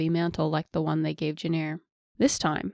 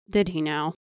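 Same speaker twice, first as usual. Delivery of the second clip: skeptical. Acted. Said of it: "Cerilius skeptically queried".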